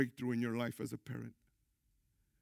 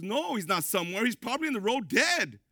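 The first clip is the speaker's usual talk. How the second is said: rising, frantic alarm, pitched higher and louder; loud, frightened wife; panicked mother; 8 semitones higher, 6 dB louder than usual. Retold or imitated. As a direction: play the mother sure her son is dead. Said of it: "My wife was losing it".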